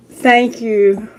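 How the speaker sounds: usual speaking voice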